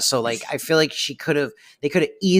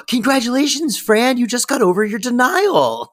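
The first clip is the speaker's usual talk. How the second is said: imitating a thrilled doctor, much higher and brighter in pitch; mock excited doctor; 10 semitones higher, 4 dB louder than usual. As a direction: burst out with the doctor's hammed-up delight at progress. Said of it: "the doctor very excitedly going".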